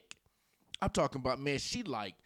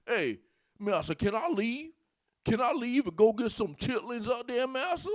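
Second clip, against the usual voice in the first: mock servant pleading, ending in a fake cry; mock pleading slave; 9 semitones higher than usual. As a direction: ham up a begging, frightened servant. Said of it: "Sound like a slave out here".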